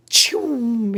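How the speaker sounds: high whooshing choom; toast launch sound effect. Pretend. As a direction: make the toast fly up.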